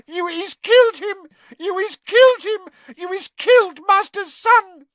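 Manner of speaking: very high, loud squeaky elf cry; frantic elf wail. A narrator's reading. Winky wails at Dumbledore over her stunned master. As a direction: shriek the accusation in despair.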